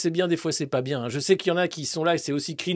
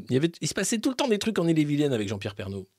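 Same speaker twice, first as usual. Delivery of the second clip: ordinary delivery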